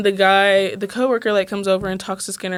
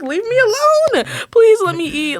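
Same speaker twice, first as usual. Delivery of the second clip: high, drawn-out mock whine; slow, high-pitched pestered complaint; exasperated plea; 12 semitones higher, 4 dB louder than usual. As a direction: plead to be left alone, hammed up. Retold plea to hovering waiters.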